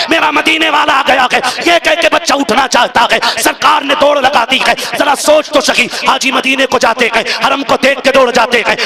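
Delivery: preacher swelling toward the emotional peak; full-force preaching. Acted. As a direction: drive the story to its climax with mounting fervour. Climactic sermon narration.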